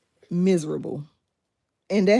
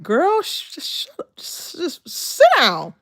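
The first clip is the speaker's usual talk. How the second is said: mock scolding; 6 semitones higher, slower than usual. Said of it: "I'm like".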